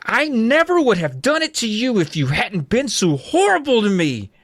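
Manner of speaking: high, furious outburst; losing it, high and emphatic; shrieking. A narrator's reading. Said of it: "I shrieked".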